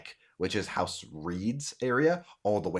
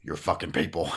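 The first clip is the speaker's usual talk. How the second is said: lower, restrained disbelief; low incredulous echo; 4 semitones lower than usual. Acted. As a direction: repeat the accusation in a low voice. Man echoes the accusation.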